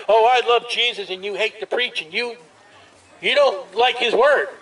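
normal talking voice

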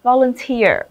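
"Volunteer" as vo-lun-TEER; stress on last syllable. Shown as a demonstration. Teacher showing which syllable carries stress, vo-lun-TEER. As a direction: lean on the final TEER of "Volunteer". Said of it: "trọng âm sẽ rơi vào".

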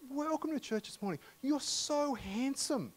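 high-pitched, unhurried greeting at the church door; earnest compliment. Retold or imitated. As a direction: stretch out so handsome with innocent delight. Pastor voices Georgia complimenting a man.

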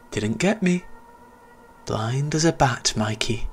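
put-on high, teasing child voice fading to a whisper; giggling ghost boy. Invisible boy taunting Mikey, high giggling tease. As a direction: taunt him like a spiteful child. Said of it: "The voice giggled again".

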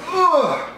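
sharp, high "ugh" sliding down; high-pitched groan. Real, not acted. Vlogger reacting to the drinks he was given, high groan.

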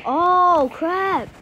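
loud, high outburst; real startled exclamation; dismayed yell. Real, not acted. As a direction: cry out at a game mishap. Reacts to game mishap.

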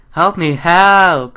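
high, loud, slow half-laughing plea; frightened cry for help. Real, not acted. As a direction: wail for help after a scare. Jump scare in horror game.

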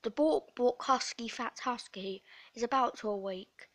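ordinary delivery

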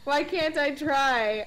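slow, drawn-out mock whine; mock whining. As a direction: whine it like a hurt kid. Mock hurt after failed scare.

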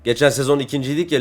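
ordinary talk

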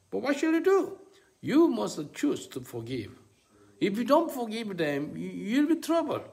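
loud, high-pitched preacher's warning; urgent preaching. Real, not acted. Preacher warns listeners they must forgive, urgent exhortation. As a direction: press the warning home at full force.

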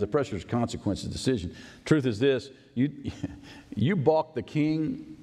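ordinary delivery

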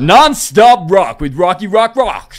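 very loud, drawn-out American station jingle; American radio announcer. Pretend. Host finishing the mock promo, booming American announcer voice. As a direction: belt it out like a US rock DJ. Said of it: "We got an accent now".

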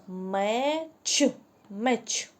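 «меч» said slowly and clearly; slow it down so kids catch the «ч»; slow teacher delivery; slow clear word. Shown as a demonstration. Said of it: "Де ви чуєте звук «ч»".

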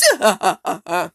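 put-on gloating villain chuckle; Ride villain laugh. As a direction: cackle in short bursts at a trapped victim. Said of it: "That was my Ride impression".

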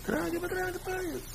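very high, soft falsetto; high mock voice. Preacher mimicking talk of twirling payes, tiny falsetto voice. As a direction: squeak it softly, far above your own voice. Same line repeated in falsetto.

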